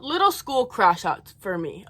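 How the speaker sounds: usual speaking voice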